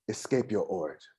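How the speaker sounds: softly subdued, touch of sadness; a little sad. Acted. Patron relating to the bard's origins, a little sad. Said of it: "he kinda gets a little sad".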